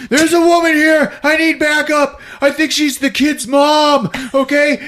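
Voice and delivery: high, loud, panicked; father yelling into the radio for backup, high and loud; frantic radio call. Acted. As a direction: scream for backup. Dad radioing for help.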